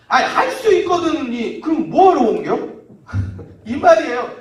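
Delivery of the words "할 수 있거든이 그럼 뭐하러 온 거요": high, loud, exasperated questioning; indignant challenge. Acted. Jesus asking the father why he came, indignant. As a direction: demand to know the point.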